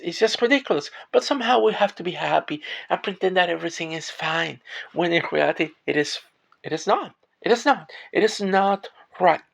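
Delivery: pushing the words out through real distress; upset, near tears. Real, not acted. Ranting about corporations not paying workers, upset and near tears. Tearful venting about wages.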